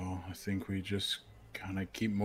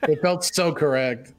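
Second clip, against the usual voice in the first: laughing; 7 semitones higher, 4 dB louder than usual. Real, not acted. Laughing at own joke.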